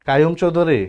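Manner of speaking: ordinary talk, nothing marked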